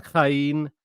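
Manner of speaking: "Chayin" with a throaty kh onset; guttural K sound. Shown as a demonstration. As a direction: scrape the back of the throat to begin "Chayin". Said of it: "that guttural sort of K sound".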